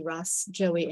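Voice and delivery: ordinary talk, nothing marked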